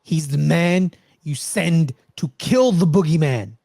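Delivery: slow, lightly voiced ominous gangster line; slow ominous quote. Fan quoting the boss's boogeyman line, slow and ominous. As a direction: drag it out like a legend being told. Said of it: "I think Avi says".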